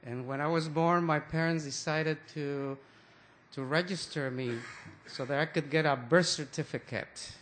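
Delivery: usual speaking voice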